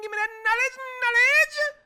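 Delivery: soaring falsetto reach; high falsetto. Pretend. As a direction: push up into a squeaky high falsetto. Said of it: "you didn't know I can reach those?".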